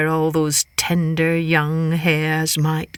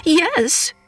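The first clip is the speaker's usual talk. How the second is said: high, sweet and innocent; high innocent Hare voice; 10 semitones higher than usual. Acted. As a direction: play the innocent little hare.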